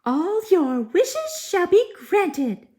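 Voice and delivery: very high, loud fairy cry. Acted. Fairy granting Prince Cinders his wishes, high and loud. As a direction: proclaim it like a bright little fairy. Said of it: "cried the fairy".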